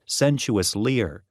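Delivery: usual speaking voice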